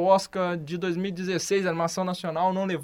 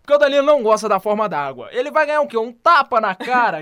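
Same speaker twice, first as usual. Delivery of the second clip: mock outrage; 4 semitones higher, 8 dB louder than usual. Mock threat over film opinion.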